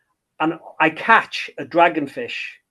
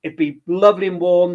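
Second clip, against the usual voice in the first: normal talking voice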